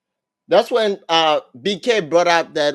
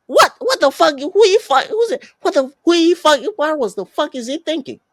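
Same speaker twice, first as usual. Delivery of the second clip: high-pitched, incredulous outburst; mock a stunned, furious reaction; outraged disbelief; 9 semitones higher than usual. Twerk's outraged reaction, retold.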